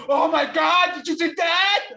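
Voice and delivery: high, loud hysterical shriek; over-the-top imbecilic yelling; mock commentator screaming. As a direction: scream it like an overexcited wrestling commentator. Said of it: "Gritar y gritar y gritar como un imbécil".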